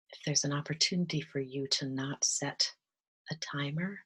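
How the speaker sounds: soft, calm meditation voice with whispered stretches; gentle guided meditation, drifting into whispers. Acted. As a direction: ease them into stillness, half-whispering.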